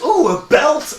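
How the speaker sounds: high, loud goofy wonder; mock homeless guy. Mocking the show's hero finding a belt, high goofy voice. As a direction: squeal it like a guy finding treasure. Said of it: "some homeless guy that goes".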